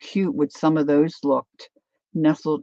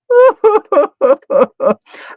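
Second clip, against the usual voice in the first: burst of laughter; 14 semitones higher, 13 dB louder than usual. Real, not acted. Reacting to friend's comment.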